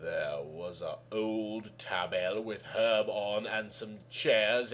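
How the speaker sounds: plain reading voice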